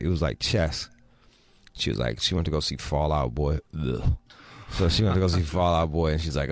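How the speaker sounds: ordinary talk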